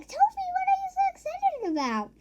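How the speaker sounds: put-on high baby-talk voice to a pet; baby talk to dog. Kids asking their happy dog why, high baby talk. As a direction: coo at an excited dog, pitched far up. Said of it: "And we were like".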